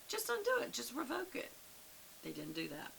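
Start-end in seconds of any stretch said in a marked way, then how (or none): 0.1-1.5 much higher-pitched, imploring aside; acting out an incredulous plea, pitched way up; pleading thought. Teacher voicing her thought at the father, high-pitched plea.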